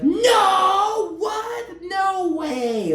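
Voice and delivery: squealing high, shocked denial. Retold or imitated. Latoya denying the kiss to Candy, shocked squeal. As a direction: shriek it like she can't believe it. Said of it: "And Latoya was like".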